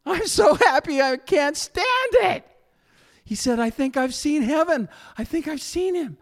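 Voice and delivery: high, loud, almost shouting with joy; overjoyed. Retold or imitated. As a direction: burst out in ecstatic happiness. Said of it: "He goes".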